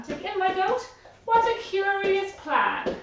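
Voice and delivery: put-on high, puzzled old lady voice. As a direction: wonder aloud at the odd plan.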